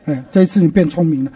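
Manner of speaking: ordinary delivery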